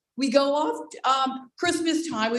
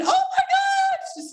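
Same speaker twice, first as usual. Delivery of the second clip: very high, shouted oh my gosh, slow; retold scream; slower than usual. Cold water hits sensitive teeth, slow high scream. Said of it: "like, screaming".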